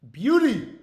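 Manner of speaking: high, loud burst of delight; excited shout. Real, not acted. Streamer celebrating his slip with a loud, high cheer. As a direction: shout it out in triumph.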